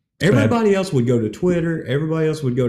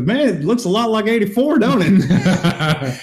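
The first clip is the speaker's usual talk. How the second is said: higher-pitched taunt, not truly shouted; mild mock yell; 7 semitones higher, slower than usual. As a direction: imitate calling out a gloating comeback. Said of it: "yells at him, and he goes".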